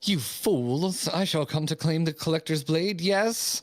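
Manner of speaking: grand, scornful noble lady; haughty noblewoman. Acted. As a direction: gloat like a proud aristocrat. Noble villain monologue.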